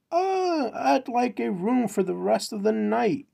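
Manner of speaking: high, awkward mock request; hesitant customer. Man asking for a room, high and hesitant.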